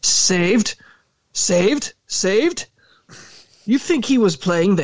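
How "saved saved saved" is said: high, incredulous sputter; spluttering. A narrator's reading. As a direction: choke on the word in outrage. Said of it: "he spluttered".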